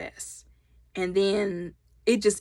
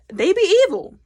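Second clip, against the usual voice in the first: higher, louder sassy punchline; joking verdict on fairy tales; sassy emphasis; 10 semitones higher, 6 dB louder than usual. Real, not acted. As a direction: land it with attitude. Playful emphatic conclusion.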